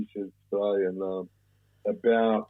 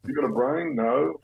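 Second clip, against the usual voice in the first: higher-pitched mock interview patter; mock job interviewer; 7 semitones higher than usual. Mocking prison officers' hiring, mock interviewer. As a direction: quiz the applicant in mock-interview style.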